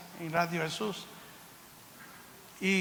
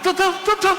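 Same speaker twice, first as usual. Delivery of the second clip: imitated heartbeat; 9 semitones higher, 5 dB louder than usual. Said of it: "corazón latiendo".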